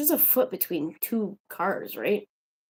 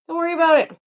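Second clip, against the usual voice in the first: higher, louder brush-off; exasperated retort; 8 semitones higher, 7 dB louder, much faster than usual. Real, not acted. Vlogger answering the nosy neighbour back, exasperated retort. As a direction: tell a nosy neighbour off, voice raised.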